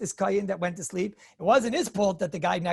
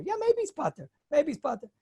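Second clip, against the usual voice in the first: high-pitched concession; 7 semitones higher than usual. Real, not acted. Answering an objection that he may be exempt, high-pitched concession.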